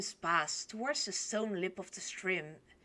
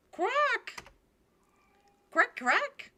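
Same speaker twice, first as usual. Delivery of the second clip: high-pitched grating quacks; duck quack; 5 dB louder, much slower than usual. Acted. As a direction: squawk like a confused drake. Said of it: "why is my dog voice so loud and grating?".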